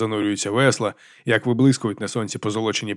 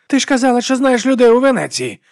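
high, loud whine; whining; 13 semitones higher, 7 dB louder than usual. A narrator's reading. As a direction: whine an accusing complaint. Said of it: "скиглив брат Діас".

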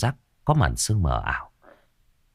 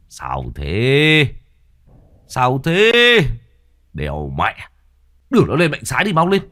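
rising, louder alarmed call; alarmed shout; 7 semitones higher, 6 dB louder than usual. A narrator's reading. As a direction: bark urgent orders. Thiết alarmed orders.

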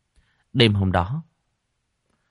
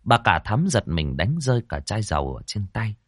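usual speaking voice

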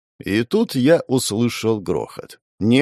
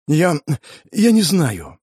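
higher, halting admission; panicked hesitation; 4 semitones higher, slower than usual. Acted. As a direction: stammer it with a pause, at a loss. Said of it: "Паника в глазах сильнейших людей планеты".

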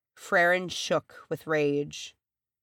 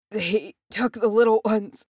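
strained, higher, louder dying voice; dying gasp; 5 semitones higher, 5 dB louder than usual. A narrator's reading. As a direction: force it out, badly wounded. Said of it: "he managed through blue lips".

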